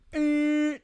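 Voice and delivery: high, sharp nasal honk sound; imitated horn blare. Shows how his head hit the car horn, high honk. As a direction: mimic the horn going off. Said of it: "like that, that's what I did".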